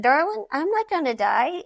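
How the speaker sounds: lightly voiced grandpa, "Darling" said high with a lilting Texas or Colorado twang; Texas drawl darling. Grandfather reassuring his crying granddaughter, a light Texas twang. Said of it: "you're in either Texas or Colorado when they say darling".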